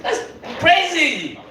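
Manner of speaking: slow, drawn-out amazement; amazed. Retold or imitated. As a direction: stretch it out in disbelief. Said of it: "I was like".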